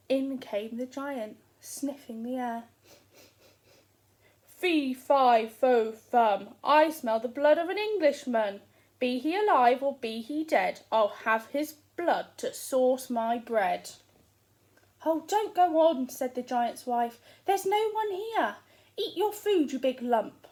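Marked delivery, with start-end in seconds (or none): 4.5-13.9 booming giant. Acted. The giant sniffing for Jack, booming loud. Giant booms his chant. 15.0-20.5 loud, exasperated nagging; scolding wife. Acted. Giant's wife waving off her husband, loudly exasperated.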